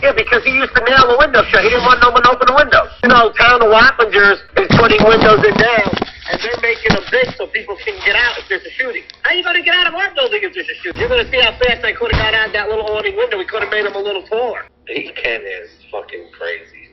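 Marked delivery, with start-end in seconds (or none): 0.0-5.4 loud, furious ranting about the windows; real angry rant. Real, not acted. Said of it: "I'm so fucking pissed". 14.9-16.8 much softer, lower muttering under the breath; muttered grumble. Real, not acted. Grumbling alone about Ken.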